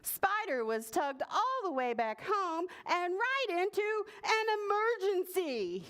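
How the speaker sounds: high, excited storybook narration; high-pitched dramatic narrator; climactic excitement. A narrator's reading. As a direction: build alarm toward the emergency.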